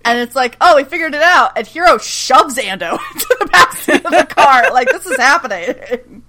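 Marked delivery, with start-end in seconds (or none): 0.6-1.5 excited realization. Retold or imitated. Characters voiced in retelling.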